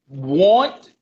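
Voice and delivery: usual speaking voice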